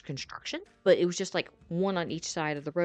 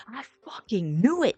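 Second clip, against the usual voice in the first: loud, raised-pitch imitated outburst; retold yell; 5 semitones higher, 8 dB louder than usual. Friend at sudden headlights behind the car, imitated yell. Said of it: "Casey, he just yells".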